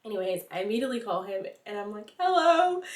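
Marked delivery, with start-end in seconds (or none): 2.2-2.8 high, teary hello; a small crying hello; tearful hello. Retold or imitated. Calling boyfriend after vomiting.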